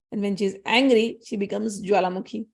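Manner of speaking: ordinary delivery, nothing marked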